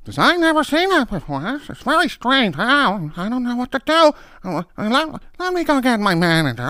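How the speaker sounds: put-on very high, far-off fretful old woman; high whiny dithering; helpless clerk.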